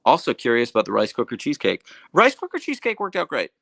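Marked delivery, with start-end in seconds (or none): 2.1-3.5 higher and louder, bursting with pride; thrilled it turned out; excited. Real, not acted. Answering chat about her rice cooker cheesecake, excited.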